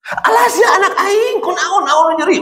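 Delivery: high, frantic shriek of a mother; panicky mother. Retold or imitated. Mother fretting over her fallen toddler, high panicked shriek.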